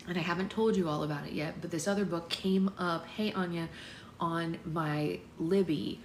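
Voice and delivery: ordinary talk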